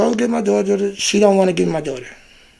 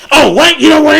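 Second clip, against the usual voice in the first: higher, louder furious yell; angry cop shout; 8 semitones higher, 9 dB louder than usual. Retold or imitated. Officer about to arrest the speaker, angry shout. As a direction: explode like a cop who's had enough.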